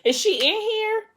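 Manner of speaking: very high, incredulous panicked question; mock freaking out. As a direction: squeal the question in pretend panic. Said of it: "freaking out, thinking like".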